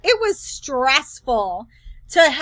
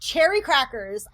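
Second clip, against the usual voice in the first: usual speaking voice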